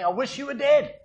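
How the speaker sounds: high, insolent son demanding his share; brazen demand. Retold or imitated. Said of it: "It was like saying".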